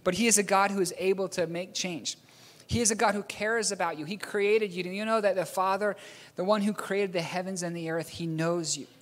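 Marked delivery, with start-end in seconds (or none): none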